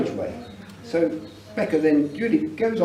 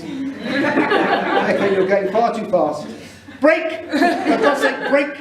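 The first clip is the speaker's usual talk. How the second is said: panicked shout; 9 semitones higher, much slower than usual. Retold or imitated. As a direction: yell brake in alarm. Dad panicking as car speeds.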